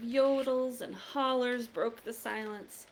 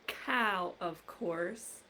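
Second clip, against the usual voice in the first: ordinary delivery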